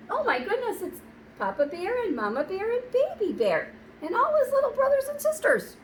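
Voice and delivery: high, thrilled exclamation; a delighted, wide-eyed gasp of surprise; teacher thrilled for the children; excited. Acted. Excited reaction to bear family.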